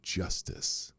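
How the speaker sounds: slow, sinking dramatic recitation; slow, falling toward a hush; dramatic poem reading. Acted. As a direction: read it like ominous poetry.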